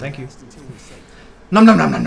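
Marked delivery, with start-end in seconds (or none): 1.5-2.1 loud playful nom nom; mock munching. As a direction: chomp it like a cartoon. Mock eating while healing.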